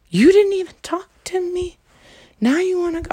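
high, disbelieving squeal; incredulous. Retold or imitated. To her silent date, high incredulous disbelief.

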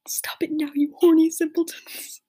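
loud, slow scolding shout; shouting professor. A narrator's reading. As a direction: shout a drawn-out telling-off. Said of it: "shouted Professor McGoggle".

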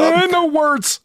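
high, loud put-on voice; mock voice. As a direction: squeak it out in a put-on voice. Mocking a flustered reaction.

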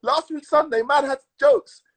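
laughing through the words; giggling while telling it. Real, not acted.

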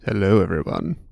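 smooth, seductive greeting; sexy voice. Acted. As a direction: purr the hello like a lounge singer. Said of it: "Super sexy voice on that one".

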